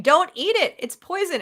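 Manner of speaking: mock yell of warning; imitated yelling. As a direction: call a frantic warning at the screen. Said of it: "my daughter's yelling at the screen".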